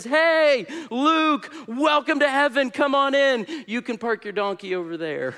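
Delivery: Saint Peter. Acted. Gatekeeper of heaven welcomes the dreamer, high and hearty. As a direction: greet a new arrival with a big call, easing into the punchline.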